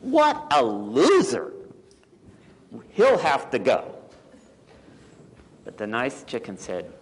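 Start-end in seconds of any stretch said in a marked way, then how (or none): 0.0-4.0 mock-scornful storybook hens; lightly acted disdain. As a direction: dismiss him with exaggerated scorn. Scornful hens reject the owl.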